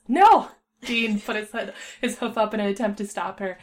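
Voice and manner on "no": loud, high exasperated shout; sharp outburst of refusal; cutting in. Acted. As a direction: shout to stop the chatter. Man cuts off chattering pony.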